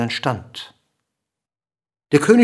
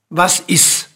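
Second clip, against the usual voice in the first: higher questioning call; 4 semitones higher, 3 dB louder than usual. A narrator's reading. King asking the dwarf once more, calling out. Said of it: "rief der König".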